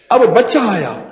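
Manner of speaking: ordinary talk, nothing marked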